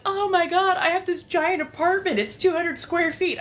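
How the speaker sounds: high-pitched, gushing mock brag; excited apartment dweller. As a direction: imitate someone gushing about their apartment. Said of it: "their apartments are like".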